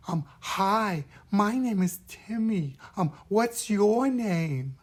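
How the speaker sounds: put-on high, airy little-kid voice; drawn-out, hesitant small child introducing themself. Shown as a demonstration. Child puppet meeting someone new, high airy voice. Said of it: "a much higher and softer voice".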